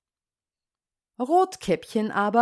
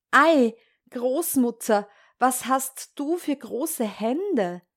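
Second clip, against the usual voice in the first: put-on higher, amazed child's question; 4 semitones higher than usual. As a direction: marvel at it like a puzzled girl.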